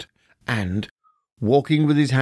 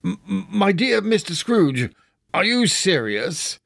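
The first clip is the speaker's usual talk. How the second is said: higher, stuttering disbelief; stammering amazement; 7 semitones higher than usual. A narrator's reading. Gentleman stunned by the donation, stammering higher. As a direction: trip over the words in shock. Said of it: "as if his breath were taken away".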